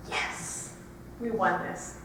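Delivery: breathy, low "yes" to oneself; retold thought, softly triumphant; hushed private triumph. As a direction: savor the win under the breath.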